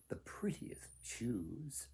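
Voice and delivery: slow, soft whispered reveal. A narrator's reading. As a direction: hush as if telling a secret. Narrator hushes the reveal.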